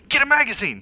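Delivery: high, loud yell over the shoulder; father shouts at wife to fetch a magazine; yelled order. A narrator's reading. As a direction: bark the order at his wife. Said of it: "he yelled over his shoulder".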